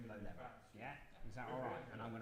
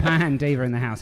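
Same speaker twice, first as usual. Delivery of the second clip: louder, upbeat show host intro; radio presenter; 3 semitones higher, 24 dB louder than usual. Acted. Presenter welcomes listeners to the live show, upbeat host voice.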